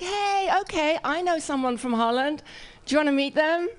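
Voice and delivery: high, eager imitated offer; eager acquaintance. People hearing she's from Holland, high eager offer. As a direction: imitate an overkeen new acquaintance.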